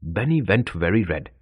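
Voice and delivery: ordinary delivery, nothing marked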